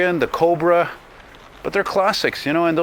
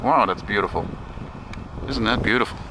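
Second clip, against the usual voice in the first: normal talking voice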